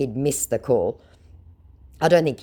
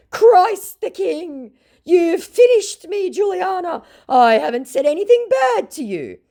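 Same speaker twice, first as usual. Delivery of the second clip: very high, loud, slow mock wail; hammed-up high scammer lament; 6 dB louder, slower than usual. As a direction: cry out dramatically as the scammer.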